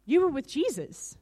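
high, insistent accuser; repeated accusation. Retold or imitated. Woman accusing Peter the second time, insistent. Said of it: "You know, the second time".

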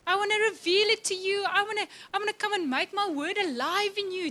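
high, loud, joyful burst with a laugh; God celebrating. Retold or imitated. God cheering on a believer, beaming and loud. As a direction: cheer her on like a delighted friend. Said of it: "He's like".

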